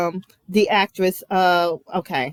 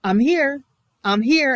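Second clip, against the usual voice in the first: passive aggressive; 4 semitones higher than usual. Retold or imitated. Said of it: "the passive aggressive version".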